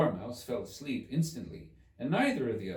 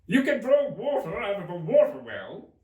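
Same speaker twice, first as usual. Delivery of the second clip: Hatter voice; 11 semitones higher, 10 dB louder than usual. Acted.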